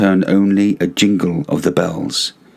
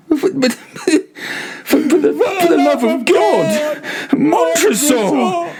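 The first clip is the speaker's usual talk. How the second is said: high, cracking plea; desperate cry. A narrator's reading. Prisoner crying to Montresor, high and terrified. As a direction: cry out in terror.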